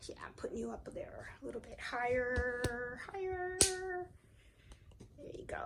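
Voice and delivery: unvoiced whisper while fixing the camera; hushed aside while adjusting the tripod. Real, not acted. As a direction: whisper it to yourself. Adjusting camera, talking softly.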